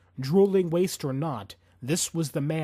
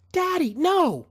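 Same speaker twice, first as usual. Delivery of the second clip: much higher and louder, a frantic cry; desperate scream; 7 dB louder, slower than usual. A narrator's reading. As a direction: scream to stop him. Daughter screams at father.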